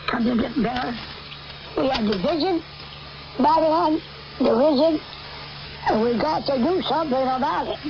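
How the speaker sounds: normal talking voice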